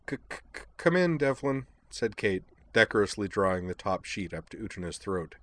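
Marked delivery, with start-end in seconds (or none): 0.1-1.7 a gentle nervous stammer; stammering girl. Acted. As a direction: stumble shyly over each start. Kate's stammering dialogue.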